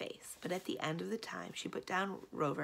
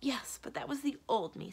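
hasty, higher-pitched correction; 7 semitones higher than usual. A narrator's reading. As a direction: jump in quickly to set it straight. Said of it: "said Belladonna hastily".

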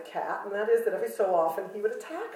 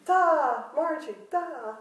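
mock duh; 8 semitones higher, slower than usual. Mock self-scolding.

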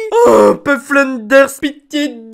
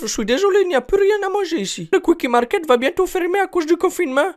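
put-on higher, fast shopkeeper accent; Apu voice; 7 semitones higher, faster than usual. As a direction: rattle it off like the shopkeeper. (voix d'Apu)

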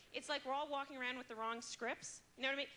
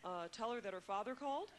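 stiff father message. Retold or imitated. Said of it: "He leaves me these messages".

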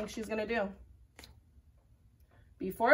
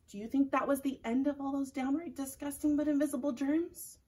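ordinary delivery, nothing marked

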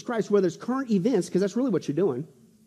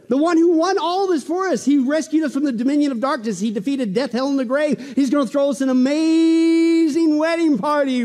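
higher, triumphant proclamation; full-force preaching; 7 semitones higher, 5 dB louder than usual. Real, not acted. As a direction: build to a long-stretched key word.

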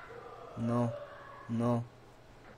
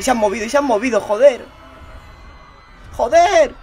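startled shout; 7 semitones higher, 14 dB louder than usual. Real, not acted. Corpses move, he yells.

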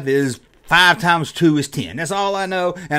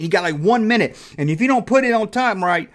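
ordinary delivery

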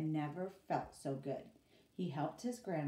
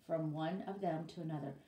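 usual speaking voice

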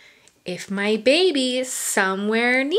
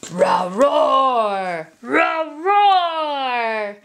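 big hammed-up roar; mock tiger roar for kids; much slower than usual. Tiger roaring in picture book.

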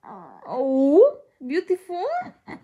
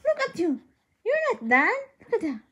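usual speaking voice

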